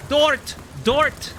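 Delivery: high, punchy imitation of a German soldier's call; mock German shout.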